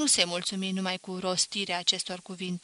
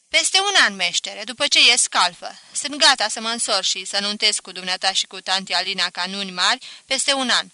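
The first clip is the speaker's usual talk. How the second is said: put-on louder, bold young promise; voiced apprentice, keen and pleased; eager apprentice; 10 dB louder than usual. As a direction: apprentice promising to wed in a year.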